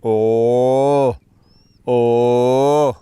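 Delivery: loud, punchy mock roar grunts; deep roar grunts. Keeper imitating the lion's roar, loud mock grunts. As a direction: bark out two heavy roar grunts. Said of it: "He goes".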